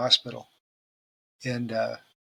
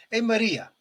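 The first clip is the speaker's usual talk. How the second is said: high-pitched calling out in a mock Italian accent; 9 semitones higher, 3 dB louder than usual. Luigi looks up at his wife while shaving, Italian accent. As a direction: call up to the wife, imitating an Italian husband. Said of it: "the Italian couple".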